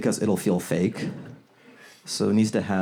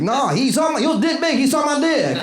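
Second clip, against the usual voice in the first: high, mock-boastful denial; mock bragging; 3 dB louder than usual. Comic playing the abductee nobody believes, high. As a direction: squeak it out like a kid caught out.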